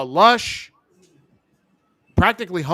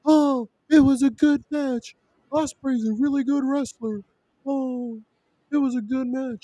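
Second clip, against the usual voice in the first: mocking fan voice; 6 semitones higher than usual. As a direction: whine it like a defensive fan. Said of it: "all the A E W marks are gonna say".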